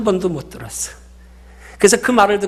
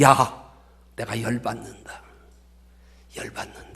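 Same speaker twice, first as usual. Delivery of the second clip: imagined God; 7 semitones lower, 6 dB quieter than usual. Pretend. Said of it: "아마 하나님이 그러실지 모르겠어요".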